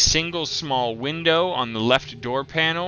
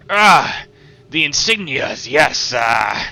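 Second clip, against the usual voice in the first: gruff shopkeeper; 3 semitones higher, 8 dB louder than usual. Acted. Shopkeeper voiced over speaker.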